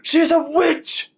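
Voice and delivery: high, bright mock cry of accusation; witch accusation. Streamer quoting the mob to chat, high mock accusing call. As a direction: call out the witch like an angry villager.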